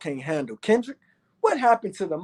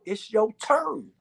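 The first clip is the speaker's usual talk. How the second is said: remembered Dick Gregory voice; 4 semitones higher than usual. Retold or imitated. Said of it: "that motherfucking voice of Dick Gregory said".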